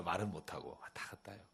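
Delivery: hushed, breathy whisper; whispered aside. Acted. Father telling the congregation how his daughter hovers silently, in a whisper. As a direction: whisper it like a secret. Daughter too shy to ask.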